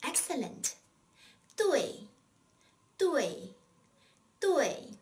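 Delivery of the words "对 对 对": 对 said duì, sharply falling, louder; fourth tone drill. Shown as a demonstration. Teacher drilling the word for right: falling duì. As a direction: drill duì with a hard fall. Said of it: "how do you say right or correct".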